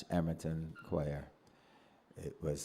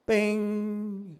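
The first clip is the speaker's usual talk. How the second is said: a high, ringing "Ping" like the steel fork; tuning fork again; 6 dB louder than usual. Retold or imitated. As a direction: sound the note for the boy. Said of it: "Hit it again".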